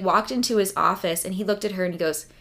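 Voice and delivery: ordinary talk, nothing marked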